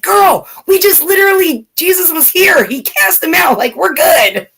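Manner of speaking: high, lively retold exclamation to a friend; excited retelling. Telling friend Stacy Jesus was here, excited. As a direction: gush it like sudden relief. I'm like.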